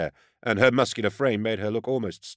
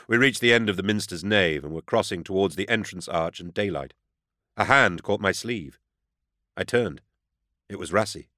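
usual speaking voice

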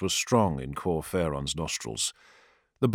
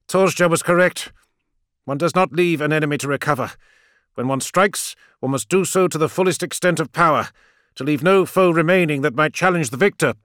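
put-on higher, louder fervent old priest; zealous priest; 8 semitones higher, 6 dB louder than usual. A narrator's reading. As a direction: lecture with cold zeal.

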